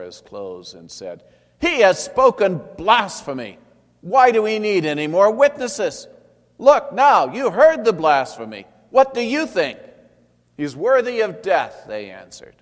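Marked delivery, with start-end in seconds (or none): 1.6-9.8 much higher, much louder, ranting in another voice; outraged high priest. A narrator's reading. High priest tearing his clothes before the council, outraged shout. 10.6-11.9 higher and much louder cry; council verdict. A narrator's reading. Council answering the high priest, loud condemnation.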